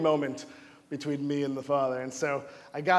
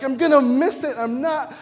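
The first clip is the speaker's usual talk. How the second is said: higher, louder flustered complaint; frustrated outburst; 9 semitones higher, 8 dB louder than usual. Retold or imitated. Preacher stuck on the bridge, almost furious. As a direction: vent like you are about to miss it. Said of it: "I'm almost furious".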